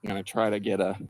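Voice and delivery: hushed golf commentary; golf commentator. Pretend. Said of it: "getting my Sports Center on here".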